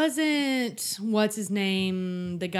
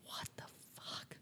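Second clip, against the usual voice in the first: whisper; 22 dB quieter than usual. Retold or imitated. Retelling stunned reaction.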